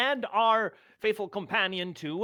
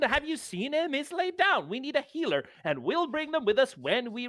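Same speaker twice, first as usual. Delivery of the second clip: high pleading voice; 7 semitones higher than usual. Acted. Player character pleading.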